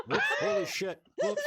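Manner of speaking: loud, high laughing outburst; cracking up. Real, not acted. Player to the table after an accent slip, loud helpless laughing. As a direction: burst out laughing at your own slip.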